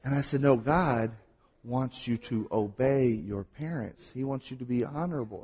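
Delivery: ordinary talk, nothing marked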